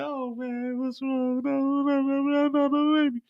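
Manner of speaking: high, hushed, then rushing mock fret; Mireya upset. Mireya at work over another baby, high rushing mock fret. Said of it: "So Mireya is like".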